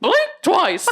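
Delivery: a worse retake of the Mickey voice; weaker Mickey Mouse. Pretend. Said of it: "It was worse that time".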